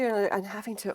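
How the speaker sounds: ordinary talk, nothing marked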